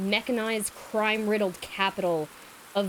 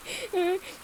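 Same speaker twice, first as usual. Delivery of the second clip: high giggle; 10 semitones higher than usual. Real, not acted. Reaction laugh.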